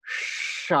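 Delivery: long unvoiced "sh"; isolated, drawn-out "sh" friction; sibilant demo. Shown as a demonstration. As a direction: hold a hushing "sh" noise. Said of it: "you have the".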